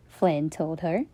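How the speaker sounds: ordinary delivery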